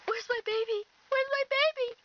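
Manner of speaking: high-pitched worried demand; anxious, high-voiced mom; frantic mother. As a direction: fret it high, searching for your child. Frantic mother looking for daughter.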